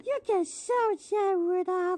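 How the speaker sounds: very high squeaky voice, soft mock sympathy; squeaky mock pity. Friend mocks Rudolph's sadness, very high squeaky voice. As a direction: coo it in a tiny cartoon voice.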